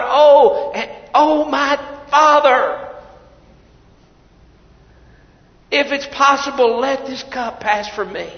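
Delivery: high, loud anguished cry to the Father; desperate garden prayer. Acted. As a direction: wail it up to heaven. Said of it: "calling out to his Father".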